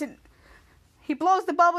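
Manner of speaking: plain reading voice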